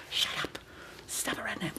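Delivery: soft whispered scolding; hushed scolding. Pretend.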